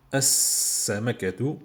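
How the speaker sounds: "As-Samakatu" slowly, gliding into a long s; direct liaison. Shown as a demonstration. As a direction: hold the doubled s of "As-Samakatu". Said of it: "Je fais directement la liaison".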